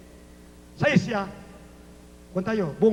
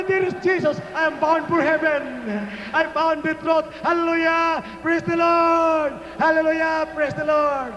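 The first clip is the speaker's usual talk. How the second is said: full-force preaching; 7 semitones higher than usual. Real, not acted. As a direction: shout praise to a big congregation. Calls congregation to shouted praise.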